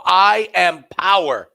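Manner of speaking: slow booming mock proclamation; booming declaration. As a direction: proclaim it like a god. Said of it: "You go".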